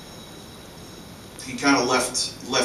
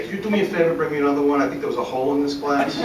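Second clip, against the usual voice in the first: higher-pitched mock-innocent plea; joking request; 5 semitones higher, 4 dB louder, faster than usual. Friend asking waiter for another drink, higher playful quote.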